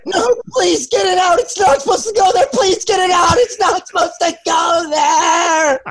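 very high, pleading hammed-up shriek; mock panicked screaming. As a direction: beg like you're terrified, shrieking and half-sung. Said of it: "screamed it all the way".

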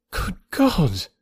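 high-pitched exclamation of shock; astonished cry. A narrator's reading. Emma hearing Harriet has accepted, astonished high cry. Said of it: "she cried".

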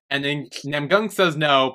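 usual speaking voice

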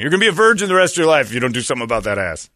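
rapid loud mocking insult; fast mocking.

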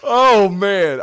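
laughing. Real, not acted. Laughing at the quote.

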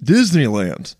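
"Disneyland" in hammed-up American English; super Englishy American accent. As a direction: overblown mock American "Disneyland". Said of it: "You're not gonna say".

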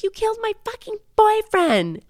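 high-pitched screechy mock rage; acted furious accusation. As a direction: screech it at the killer. Voices Needy attacking Jennifer.

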